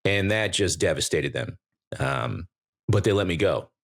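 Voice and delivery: ordinary delivery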